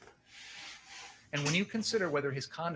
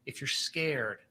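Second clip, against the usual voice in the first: normal talking voice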